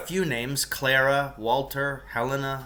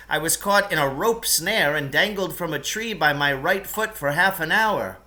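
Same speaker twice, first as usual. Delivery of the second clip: raised, higher voice of the trapped man; Lance chatting from pit; 3 semitones higher, 3 dB louder than usual. Acted. Trapped man explaining the traps to the boys, voiced higher. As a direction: explain it all matter-of-factly.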